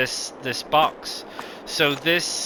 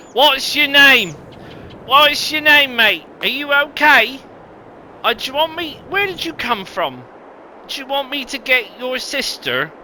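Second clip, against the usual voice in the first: lifted, concerned call to a stranger; high friendly voice checking on a lost villager; 10 semitones higher, 4 dB louder than usual. Pretend. Talking to a game villager.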